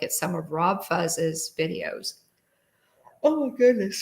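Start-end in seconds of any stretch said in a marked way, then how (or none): 3.2-4.0 spoken through a yawn; words stretched by a yawn. Real, not acted. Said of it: "sorry for my yawn".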